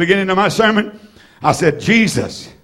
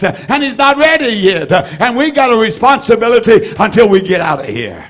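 fiery preaching; 4 semitones higher than usual. Real, not acted. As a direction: lift the congregation, preach it hard. Preaching at full force.

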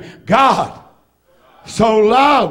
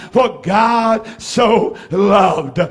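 drawn out word by word; slow solemn scripture read; slow emphatic reading; much slower than usual. Acted. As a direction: let each word hang. Preacher drawing out the text.

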